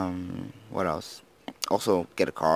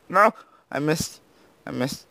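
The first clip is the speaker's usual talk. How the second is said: dismayed cry; 8 semitones higher than usual. Real, not acted. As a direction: wail it as you miss. Missing in the game.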